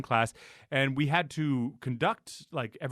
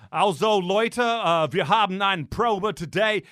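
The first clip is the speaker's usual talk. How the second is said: put-on slow German in a thick American accent; bad American German accent; 4 semitones higher, 4 dB louder, slower than usual. Mocking the American conductor's bad German, slow. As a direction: mimic the conductor's clumsy mixed German. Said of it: "just the shit accent".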